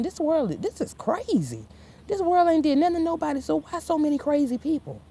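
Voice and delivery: high-pitched, rushed disbelief; worked-up rant. Real, not acted. Preaching to her viewers, high and worked up. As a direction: fire it off fast, incredulous. Exasperated rant about the crazy world.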